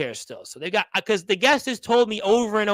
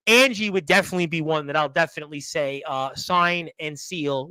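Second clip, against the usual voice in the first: normal talking voice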